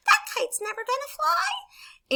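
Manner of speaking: very high, slow bird voice; squeaky storybook taunt; mocking little birds. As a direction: jeer like a tiny bird. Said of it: "little birds that are in the meadow".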